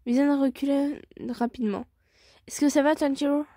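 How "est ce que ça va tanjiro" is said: French with an accidental English-accented roll of the tongue; lightly English-accented French question. Real, not acted. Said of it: "j'ai pris l'accent anglais en mode j'ai roulé".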